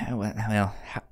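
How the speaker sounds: usual speaking voice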